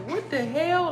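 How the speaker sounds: high shocked "what the hell"; surprised as it lets go; startled. Retold or imitated. Energy unwrapping off her hand, high shocked exclamation. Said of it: "I'm like".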